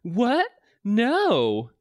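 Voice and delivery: high, hammed-up shock; shocked disbelief. Waitress hears the boss's accusation, high mock shock. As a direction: squeak it out, aghast. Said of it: "Her jaw dropped and her eyes widened".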